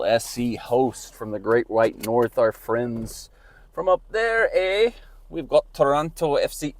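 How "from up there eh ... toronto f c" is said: mock Canadian accent, pitched up high; playful Canadian "eh" accent; hammed-up Canadian accent, tongue in cheek. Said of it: "I don't know why I started doing that accent".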